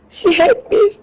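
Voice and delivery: high, sobbing words; tearful high-pitched sobbing; crying. Acted. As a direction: voice breaking with tears. Servant breaks down to master.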